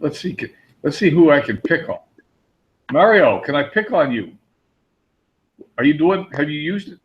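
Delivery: ordinary delivery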